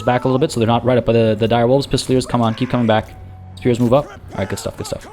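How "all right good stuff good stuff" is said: breathy whisper to himself; hushed unvoiced muttering praise. Real, not acted. Quiet aside mid-battle.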